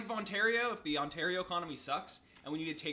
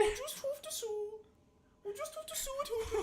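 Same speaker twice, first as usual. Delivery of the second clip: high whimpering fake sob; mock crying. Mock teary repeat.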